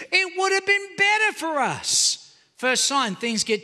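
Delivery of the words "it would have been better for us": whiny complaint. Retold or imitated. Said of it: "listen to the complaints".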